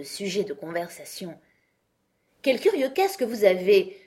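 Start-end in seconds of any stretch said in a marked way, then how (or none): 2.4-4.0 higher, louder, bright exclamation; cheerful voice. A narrator's reading. Alice changing the subject with the knight: cheerful. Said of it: "d'une voix gaie".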